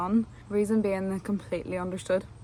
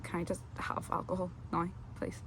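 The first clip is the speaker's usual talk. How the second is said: soft pleading; 9 dB quieter than usual. Retold or imitated. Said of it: "a woman just was like".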